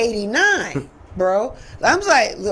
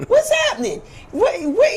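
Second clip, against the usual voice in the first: amazed disbelief; 9 semitones higher than usual. Retold or imitated. Retelling amazement at Atlanta.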